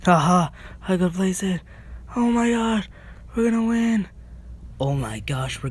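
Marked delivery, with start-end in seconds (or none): 0.0-4.1 high, loud thrilled voice; bubbling with excitement; excited. Real, not acted. About to win the game.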